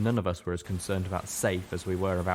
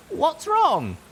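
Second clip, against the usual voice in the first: sharply higher and louder, alarmed; 8 dB louder than usual. A narrator's reading. Littlepip asks the group in sharp alarm, much higher and louder. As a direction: blurt it out in sudden alarm. Sudden alarm before an attack.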